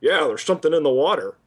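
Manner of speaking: lightly voiced offhand game sidekick; a hint of a character voice, blasé; character impression. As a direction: toss it off in a put-on casual voice. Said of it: "is him to go".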